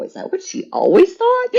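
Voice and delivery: a high, stunned little question; shocked disbelief. Retold or imitated. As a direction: act wounded amazement, voice jumping way up. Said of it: "and you go".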